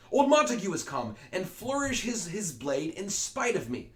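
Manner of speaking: high, loud, slowing indignation; old Capulet raging at Montague; angry old man. Acted. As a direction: spit out the rival's name in outrage.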